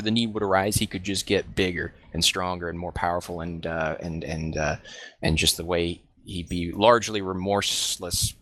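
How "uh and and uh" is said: ordinary talk, nothing marked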